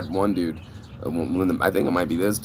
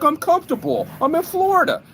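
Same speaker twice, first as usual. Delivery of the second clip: very high, louder mock voice of a laid-back guy; casual excuse; 4 dB louder than usual. The late guest shrugging off Hoffa, in a high mock voice. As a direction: squeak it out like an unbothered slacker. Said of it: "He's like".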